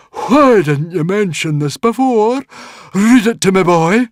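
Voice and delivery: high, loud, drawn-out character voice; demanding Druid Guardian. Acted. Druid Guardian orders the boy to read, loud and demanding. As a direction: demand it slowly and very loudly.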